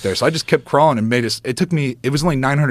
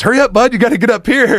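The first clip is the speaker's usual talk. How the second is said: high, loud call from above; excited urging shout; 12 semitones higher, 5 dB louder than usual. Retold or imitated. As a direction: call him up the hill, buzzing. Alex calling from the tree.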